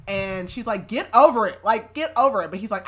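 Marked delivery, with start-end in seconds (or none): 0.9-2.5 acted exasperation, loud and raised in pitch; exasperated. Woman telling Ben off in a retold quarrel, exasperated. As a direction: hit it hard, like snapping in a quarrel.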